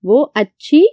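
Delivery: dictating मेरी भावज; dictation pace. Shown as a demonstration. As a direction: spell it out slowly for the class to write. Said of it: "दुरुस्त करके लिखते हैं".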